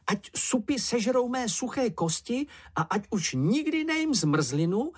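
high, lightly squeaky creature voice; gabbled oath. A narrator's reading. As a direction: babble a solemn vow up high. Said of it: "zabrebentil Čenich". (brebentivá vysoká přísaha)

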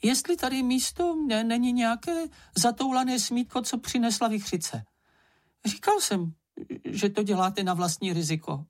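very high little voice; tiny shadow's voice. A narrator's reading. Smallest shadow answers after the storm, tiny high voice. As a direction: squeak it out like the smallest creature. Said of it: "odpověděl nejmenší stín".

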